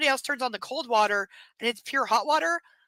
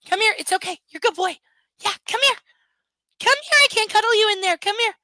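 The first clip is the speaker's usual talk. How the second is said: higher and louder, sing-song coaxing; 9 semitones higher, 9 dB louder, faster than usual. Real, not acted. Owner coaxes dog out of his cage, sing-song. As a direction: coax him out of the cage.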